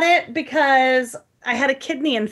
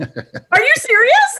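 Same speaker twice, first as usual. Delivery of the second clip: surprised; 6 dB louder, slower than usual. Real, not acted. Shocked by the tattoo reveal.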